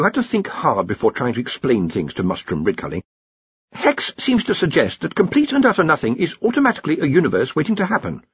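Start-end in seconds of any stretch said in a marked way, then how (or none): 3.7-8.2 higher-pitched, careful explaining voice; careful young-wizard explainer. Acted. Ponder explains the universe to the Arch-Chancellor, careful. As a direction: explain it gingerly to a superior.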